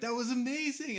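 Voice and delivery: high, gushing mock enthusiasm; retold excitement. Friends leaving the theater after the movie, imitated as excited. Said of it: "they were, like, really excited about it".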